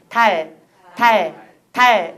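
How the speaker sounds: 달 slow and low, freezing on the L; tongue held forward. Shown as a demonstration. Teacher correcting foreigners, slow 달 with held front L. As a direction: stop and hold the tongue at the end of 달. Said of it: "그대로 멈춰라".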